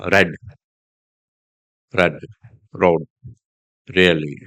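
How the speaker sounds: RED, ROAD, REALLY with light relaxed R; soft initial R. Shown as a demonstration. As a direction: separate words, soft British R at the start. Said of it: "softer than the American R".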